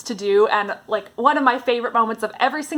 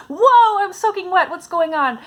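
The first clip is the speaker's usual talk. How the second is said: put-on sudden high, loud "whoa" of a girl finding herself soaked; startled surprise; 8 semitones higher, 4 dB louder than usual. Anne in the sinking boat realizing she's soaked, startled and high. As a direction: gasp it out, much higher and louder, as if cold water just hit.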